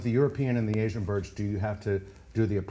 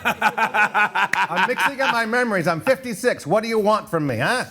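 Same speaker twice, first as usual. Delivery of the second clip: laughing; 8 semitones higher, 6 dB louder, slower than usual. Real, not acted. Teasing over own mix-up.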